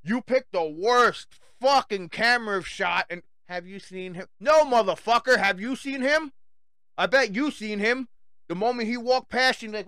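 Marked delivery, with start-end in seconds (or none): 3.5-4.3 quiet flat mock of the poster; mock police poster. 4.4-6.3 loud, higher, furious retort; angry shout. Real, not acted. Shouting back at the police.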